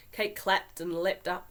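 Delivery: plain reading voice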